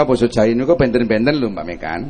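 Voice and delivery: normal talking voice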